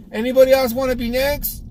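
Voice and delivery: half-hearted mock call to the room; lazy announcement. Mocking a barber calling to the shop, half-hearted. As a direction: toss it out without commitment. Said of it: "if you just announce".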